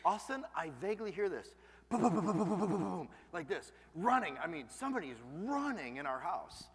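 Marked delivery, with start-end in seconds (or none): 1.9-3.1 1 mock footstep booms. Imitating running footsteps at night.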